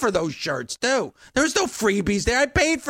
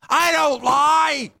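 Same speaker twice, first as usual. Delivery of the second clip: high, drawn-out outburst; indignant shout; 6 semitones higher, 4 dB louder, much slower than usual. Real, not acted. Host contrasting himself with a lying outsider, indignant shout. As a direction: shout the denial, stretching it out.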